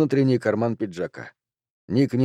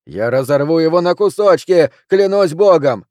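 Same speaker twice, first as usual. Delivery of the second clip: loud, high threat, climbing; yelling in helpless rage; 5 semitones higher, 6 dB louder than usual. A narrator's reading. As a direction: shout the threat while struggling to break free. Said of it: "вопил Джуниор, колотя ногами пол в бессильной ярости".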